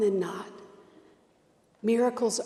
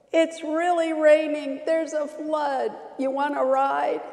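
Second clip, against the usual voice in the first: higher, louder call; helicopter pilot yelling; 6 semitones higher, 3 dB louder than usual. Acted. Pilot yelling down to the man on the roof, hammed up. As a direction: shout down over the rotor noise. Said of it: "The helicopter yells".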